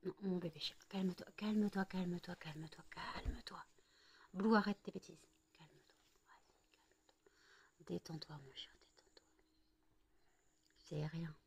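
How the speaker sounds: hushed, calming whisper; soothing whisper. Real, not acted. Owner calming her agitated cat, whispering. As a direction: breathe the words to calm the cat.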